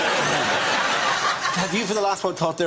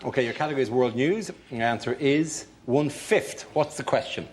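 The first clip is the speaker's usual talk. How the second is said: show host read; 2 semitones lower than usual. Acted.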